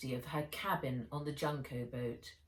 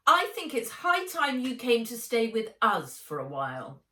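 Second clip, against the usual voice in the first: high, loud, prim aunt voice; a cold, superior aunt laying down the law; fast, lofty, domineering aunt; haughty aunt; 6 semitones higher, 7 dB louder than usual. A narrator's reading. Aunt Vera's voice.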